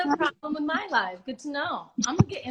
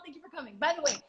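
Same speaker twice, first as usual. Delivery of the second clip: normal talking voice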